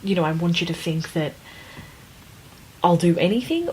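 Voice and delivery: ordinary talk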